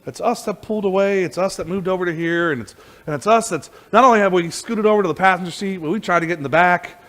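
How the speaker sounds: usual speaking voice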